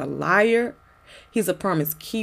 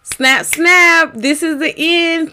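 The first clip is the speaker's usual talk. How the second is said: spoken word closing; 8 semitones higher, 4 dB louder, slower than usual. Acted. Poem closing, snapping.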